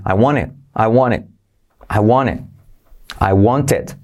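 "I want it" as "I won it"; linked, no t; dropped t. Shown as a demonstration. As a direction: drop the t of "want". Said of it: "la T de want se omite".

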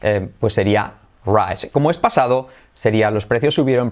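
ordinary talk, nothing marked

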